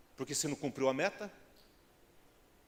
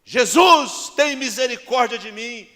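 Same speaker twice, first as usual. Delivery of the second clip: full-force, high, drawn-out cry for mercy; desperate shout; 11 semitones higher, 19 dB louder, slower than usual. Acted. Blind man calling Jesus for mercy, desperate shout. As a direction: cry out to be heard over everyone. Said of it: "ele ouviu o grito de Bartimeu".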